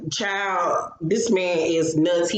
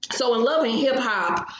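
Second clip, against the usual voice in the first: ordinary talk, nothing marked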